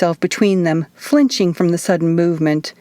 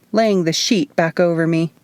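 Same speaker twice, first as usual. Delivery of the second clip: ordinary delivery, nothing marked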